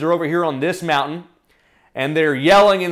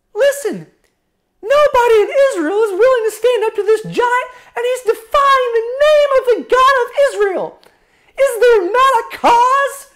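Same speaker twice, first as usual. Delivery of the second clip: put-on high-pitched earnest boy voice; high, forceful youthful protest; David; 4 dB louder than usual. Shepherd boy standing up to his brothers, high insistent voice. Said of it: "And David says".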